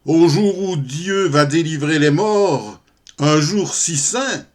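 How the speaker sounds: loud, high, slow declaimed protest; theatrical pious outrage in verse; indignant refusal. Acted. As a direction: cry it out like a stage heroine. Prostitute's indignant refusal in the poem.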